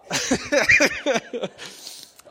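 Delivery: sudden loud high sneeze. Real, not acted. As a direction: let out a big loud sneeze. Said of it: "I'm gonna sneeze here, and it's gonna be really loud".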